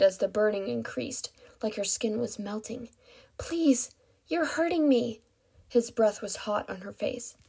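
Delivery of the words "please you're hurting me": high, desperate begging; pained plea. A narrator's reading. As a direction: beg through the pain. Captive begs in pain.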